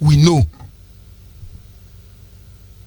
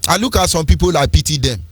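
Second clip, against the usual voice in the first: normal talking voice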